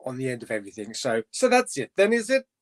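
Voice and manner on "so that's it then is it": higher sing-song Welsh caricature; Welsh accent. Acted.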